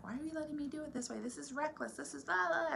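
high-pitched, fast accusing outburst; retold blaming rant. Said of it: "be blaming all this on him".

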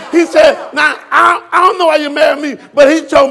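loud, high-pitched preacher's shout; full-force preaching. Real, not acted. Preacher scolding the ungodly in his church, shouting. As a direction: drive each line home at full voice.

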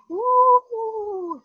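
high, far-off ghostly woo; ghost sound. Shown as a demonstration. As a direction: moan it like a ghost. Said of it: "I want you to make the ghost sound".

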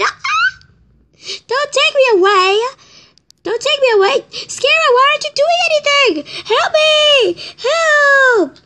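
high desperate screams; screaming for help. Acted. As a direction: scream as you're dragged off. Being dragged away.

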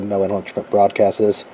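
ordinary talk

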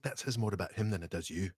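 a hint of Bobby Carlyle's Scots; Scottish accent. Comforting a nervous actor, a light Scottish accent. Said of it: "your Scottish, Bobby Carlyle accent".

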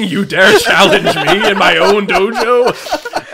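slow, loud, dramatic villain challenge; booming echoing villain monologue. Pretend. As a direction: boom it out like a martial arts villain. Mock Seagal villain challenge.

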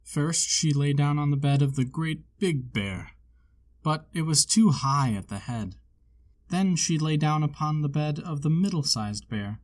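usual speaking voice

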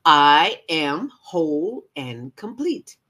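joyful big affirmation; like you won the lottery. Shown as a demonstration. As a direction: proclaim it with total conviction. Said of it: "say it like you mean it".

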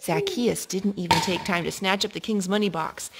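usual speaking voice

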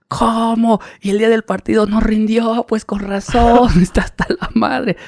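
high, incredulous fan voice; mock outraged fan. Fan imitated catching the América striker partying, high and incredulous. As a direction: play a fan putting it together, high-pitched.

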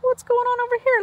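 usual speaking voice

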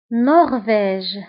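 نرويج drawn out as French nor-vège; slow French نرويج with v and final "zh"; French pronunciation. Shown as a demonstration. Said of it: "بالفرنسية أيضًا".

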